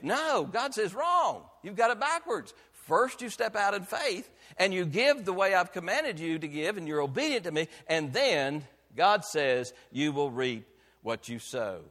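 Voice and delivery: loud, high, ringing pulpit voice; full-throated revival preaching; preaching at full force. Acted. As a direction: preach it out to the back pew. Preacher voicing God's rebuke.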